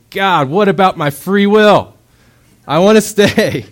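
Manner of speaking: high, loud, slow wailing protest; frantic protest. Acted. A man carried from his burning house, loud high protest. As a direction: yell it while struggling to break free. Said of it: "I'm beating at his back and say".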